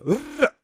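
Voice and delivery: high burst of machine-gun noise; sudden high rattling burst; imitated machine gun. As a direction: mimic a sweep of gunfire. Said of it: "The machine gun just left to right".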